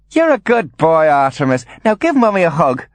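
high, cooing praise; high, emphatic motherly fondness; Angeline, doting mother. Acted. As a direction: coo to a child in a very high voice. Narrator voices Angeline.

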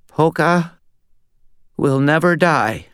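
high, weak, frail voice. Acted. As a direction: barely get the words out. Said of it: "he said in a very weak voice".

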